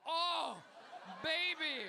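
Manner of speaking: loud, high swoon; over-the-top turned-on outburst; mock lustful excitement. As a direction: exclaim it in hammed-up delight. Mock swooning over cracked phone screen.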